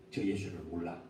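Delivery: low, soft sworn denial; Peter's oath. Acted. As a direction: deny him quietly under oath. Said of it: "맹세하면서 자기가 말합니다". (베드로의 낮고 조용한 맹세)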